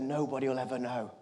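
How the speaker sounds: very soft, low voice; kindly secret between two people; hushed reassurance. Retold or imitated. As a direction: reassure someone confidentially, barely aloud. Conductor quietly reassures the boy.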